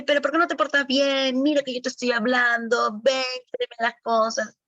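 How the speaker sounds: put-on a fed-up grown-up nagging a kid; scolding adult. Teacher scolds child, acted quote. (regaño a un niño, voz más aguda)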